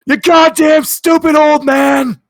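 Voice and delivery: high, loud, slow shout; screaming. Acted. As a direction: scream it in a rage. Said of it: "You hear Jon Arbuckle scream".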